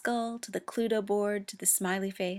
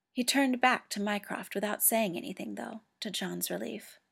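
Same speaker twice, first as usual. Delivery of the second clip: plain reading voice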